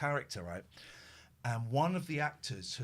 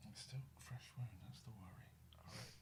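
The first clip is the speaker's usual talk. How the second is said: whisper; 4 semitones lower, 20 dB quieter than usual. Real, not acted. Whispering over the injured cat.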